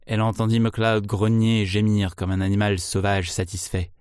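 ordinary delivery, nothing marked

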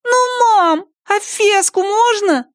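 put-on very high, drawn-out child voice; pleading little girl. A narrator's reading. Daughter begs mom to let her play, high child's plea. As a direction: stretch it out like a begging child.

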